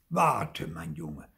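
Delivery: put-on the outraged master snaps at the boy; sharp, indignant call. A narrator's reading. Master scolding the runaway puppet, indignant. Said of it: "rief der empörte Meister".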